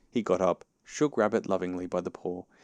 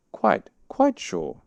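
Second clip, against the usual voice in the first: high, slow, louder insistence; Pooh assuring; 13 semitones higher, 7 dB louder, much slower than usual. A narrator's reading. Pooh assuring Rabbit who he is, slow insistence. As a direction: insist slowly, pitched high. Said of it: "said Pooh".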